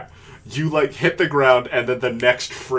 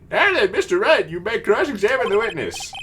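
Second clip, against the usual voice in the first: high-pitched, put-on judge voice; lofty judge voice, pitched well up; courtroom judge; 9 semitones higher than usual. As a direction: pronounce it from the bench in a high character voice.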